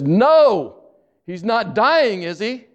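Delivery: high, loud, frightened cry of no; panicked daughter. Retold or imitated. Daughter on the phone hearing her father is ill, panicked high cry. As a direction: retell her sudden panic, voice jumping high.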